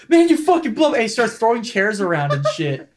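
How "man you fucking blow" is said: retold insult, high-pitched; mock furious outburst, higher; angry janitor. Friend retelling the janitor's insult, mock angry. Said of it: "the dude fucking just goes".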